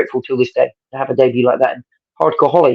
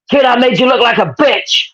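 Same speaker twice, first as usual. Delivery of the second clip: a much higher, mocking attempt at an American accent; 11 semitones higher than usual. After slamming the kid into a wall, mock American accent. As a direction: taunt him in an American accent. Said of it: "I can't do an American accent, but I'll try".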